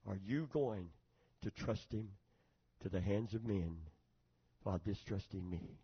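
hushed divine question to the heart; precious voice of the Lord. Retold or imitated. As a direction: speak softly and solemnly, phrase by phrase. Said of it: "he so preciously spoke to my heart".

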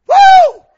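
very high, loud preacher's whoop; ecstatic whoop. Real, not acted. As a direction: let out a joyful woo. Ecstatic shout mid-quote.

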